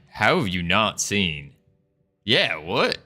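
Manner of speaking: normal talking voice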